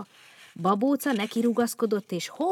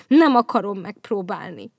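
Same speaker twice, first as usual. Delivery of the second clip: sulking; 8 dB louder than usual. Acted. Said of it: "duzzogott a szarvasbogár".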